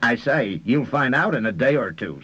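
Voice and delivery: gloating mockery, pitch lifted; mocking. A narrator's reading. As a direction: mimic the victim's words to taunt him. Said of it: "said the mocking voice of Mister Lomer".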